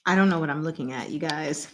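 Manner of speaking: usual speaking voice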